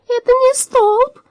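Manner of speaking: high, slow sighing giant; sighing robot voice. A narrator's reading. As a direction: sigh it out in the high metal voice. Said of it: "вздохнул Дром".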